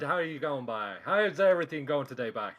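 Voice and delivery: Newfoundland lilt, ending each question on "by"; Newfoundland accent. Shown as a demonstration. Asking the guys how they're going, Newfoundland accent. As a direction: greet them the Newfoundland way. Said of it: "do it in the accent".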